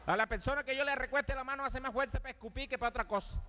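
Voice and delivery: high, bragging tough guy yell; high bragging giant; boastful shout. Retold or imitated. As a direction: puff up and brag, high. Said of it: "Y uno grita".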